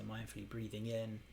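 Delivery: soft cue with short and long lifted at the end; meditation cue demo. Shown as a demonstration. Teacher demonstrating the breath cue to the class, softly. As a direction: guide the breath calmly, tag each length. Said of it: "I like to put the long or short kind of at the end".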